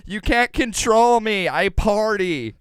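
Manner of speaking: loud, high, drawn-out mock defiance; hammed-up defiant girlfriend; defiant party girl. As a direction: wail it slowly like a rebellious partier. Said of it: "she's just like".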